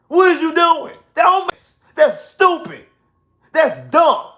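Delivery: high, loud, fed-up rant at a dumb call; exasperated yelling. Real, not acted. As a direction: let it rip, disbelief turning to fury.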